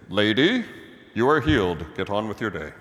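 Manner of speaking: loud, curt dismissal of a stranger; brushing her off. Retold or imitated. Pastor voicing a cold Jesus dismissing the woman, curt and loud. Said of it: "He doesn't just say".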